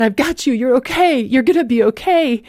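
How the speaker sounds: urgent reassurance. Retold or imitated. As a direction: reenact urgently reassuring someone in danger. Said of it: "I said".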